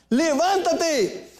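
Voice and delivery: slow, high, drawn-out command; preached call. Acted. Preacher calls the sleeper to rise, slow and high. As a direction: stretch the word and lift it.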